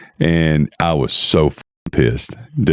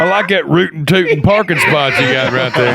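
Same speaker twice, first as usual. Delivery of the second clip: higher, laughing mock cowboy voice; 11 semitones higher than usual. Teasing the storyteller as a cartoon cowboy, laughing. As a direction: ham up a rootin' tootin' cowboy through laughter. Mocking the cowboy parking confrontation.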